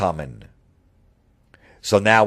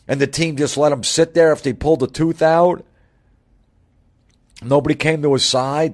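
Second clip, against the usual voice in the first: higher and louder, hammed-up alarm over nothing; mock-panicked sarcasm, pitched up; sarcastic mock outrage; 8 semitones higher, 5 dB louder than usual. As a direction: play up the fake concern like a scandal. Mock outrage over pulled tooth.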